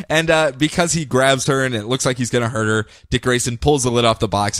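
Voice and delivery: ordinary talk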